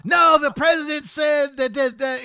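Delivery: loud, high, hammed-up insistence; retelling the angry guy at the fire station, loud and high; mock indignant shout. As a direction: mimic the pushy guy yelling back. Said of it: "this motherfucker's just like".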